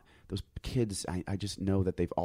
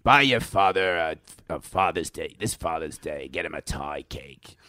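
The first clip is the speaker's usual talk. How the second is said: gross pitchman voice, sinking lower; a disgusting TV ad voice, dropping low; Carvel ice cream man; 3 semitones lower, 4 dB louder than usual. Retold or imitated. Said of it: "sounded like the Carvel ice cream man".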